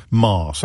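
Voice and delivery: "Mass" with long broad a, mahss; posh mass. Shown as a demonstration. Presenter repeating his school's posh mass. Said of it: "That's how posh my school".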